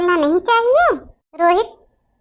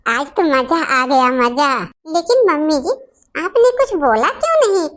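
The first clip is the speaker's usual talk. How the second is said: usual speaking voice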